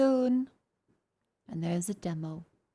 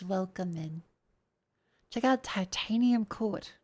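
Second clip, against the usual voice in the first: usual speaking voice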